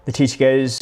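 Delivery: ordinary talk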